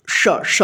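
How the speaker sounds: श, श breathed out with no voice; just hiss श, श twice; whispered sound demo. Shown as a demonstration. Teacher showing pupils how श sounds. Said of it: "तब इसका उच्चारण होता है दोनों का".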